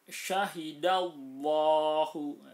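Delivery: syahidallahu, slow and loud, with a thick, heavy lam in Allah. Shown as a demonstration. Teacher demonstrating the thick lam, slow and loud. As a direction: recite syahidallahu slowly with a full, heavy lam. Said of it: "dibaca tebal lamnya".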